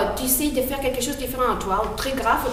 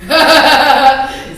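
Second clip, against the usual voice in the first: loud villain laugh; big hammy wolf guffaw; booming wolf laugh; 5 semitones higher, 13 dB louder than usual. Acted. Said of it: "c'est les loups". (rire tonitruant de loup)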